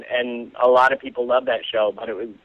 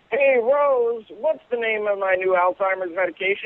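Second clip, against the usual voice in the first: put-on much higher old-man voice calling to his wife; punchline, old man turns to call his wife, higher voice; 11 semitones higher than usual. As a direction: call across the house like an old husband. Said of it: "the other guy turns and he says".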